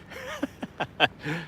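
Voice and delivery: soft, voiceless chuckle; breathy laugh. Real, not acted. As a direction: laugh quietly in relief after a scare. Laughs off the panic.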